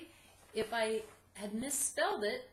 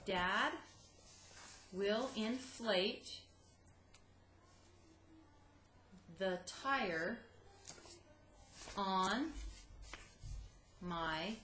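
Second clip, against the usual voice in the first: very slow, long pauses between words; word by word dictation; much slower than usual. Shown as a demonstration. Teacher dictating word by word, very slow. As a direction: dictate each word for the kids to write. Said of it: "read it again slowly for you".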